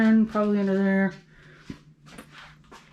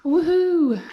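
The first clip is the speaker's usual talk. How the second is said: excited whoop; 9 semitones higher, 4 dB louder than usual. Real, not acted. Cheers at the front room.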